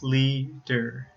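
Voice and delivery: "leader" in two separate syllables, lea, der; split into syllables. Shown as a demonstration. Teacher counts two syllables, "leader" said as lea, der. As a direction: break "leader" before the D. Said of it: "two syllables".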